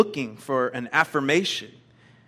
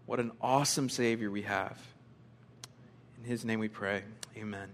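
public prayer; 4 semitones lower, 4 dB quieter than usual. Real, not acted. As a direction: pray reverently, pausing between phrases and softening at the close. Leads congregation in prayer.

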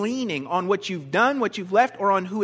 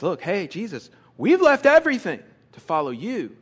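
proud proclamation; 3 dB louder than usual. Acted. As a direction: proclaim it to the teacher with excitement. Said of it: "Peter is kind of proclaiming".

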